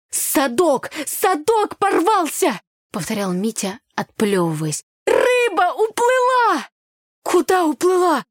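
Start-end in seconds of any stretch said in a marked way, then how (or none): 0.1-2.6 high, drawn-out wail of dismay; alarmed cry. A narrator's reading. Boy in the water over his torn net, alarmed. 5.1-6.7 very high, loud, drawn-out cry; dismayed wail. A narrator's reading. Boy who lost his catch, wailing in dismay. 7.2-8.2 high, loud, startled question; gasped question. A narrator's reading. Girl hearing the fish escaped, aghast. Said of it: "ахнула я".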